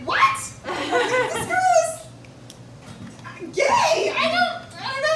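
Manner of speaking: bursting out in high disbelief; high shocked shout. Retold or imitated. Telling the audience how he reacted, high shocked shout. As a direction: squeal it way up high, half laughing.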